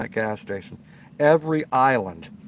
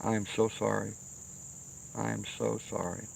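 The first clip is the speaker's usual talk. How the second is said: softer, hushed, heartfelt sorry; sincere apology; 3 semitones lower, 10 dB quieter than usual. Pretend. To the lady he cursed, a soft sincere apology. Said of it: "I'd say".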